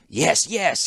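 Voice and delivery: eager clockmaker pressing the point; emphatically. Acted. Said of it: "he said emphatically".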